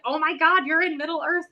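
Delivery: high, gushing pretend awe; mock amazement. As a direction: act wowed like a fantasy fan. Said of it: "And I'm like".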